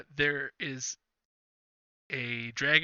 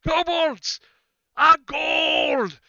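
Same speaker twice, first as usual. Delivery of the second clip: rallying cry; 10 semitones higher, 9 dB louder, much slower than usual. Acted. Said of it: "he pulls his sword out and he's like".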